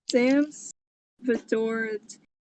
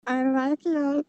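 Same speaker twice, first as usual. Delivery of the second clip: high, creepy creature voice; a gollum-like creep; Smeagol voice; 5 semitones higher than usual. Acted. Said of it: "That is terrifying".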